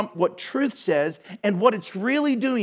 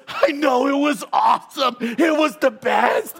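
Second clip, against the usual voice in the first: gloating prankster; 6 semitones higher, 4 dB louder than usual. Retold or imitated. Frank gloating after his prank.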